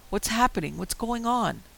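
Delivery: panicked yelling. A narrator's reading. Narrator screaming at the thing in the woods, panicked. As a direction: yell out in confused panic. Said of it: "I was screaming".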